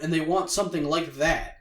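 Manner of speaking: ordinary delivery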